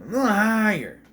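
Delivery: high, loud put-on coaching voice; imitated voice coach. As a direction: coach an actor by pitching it up. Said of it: "Brad Dourif was coaching him".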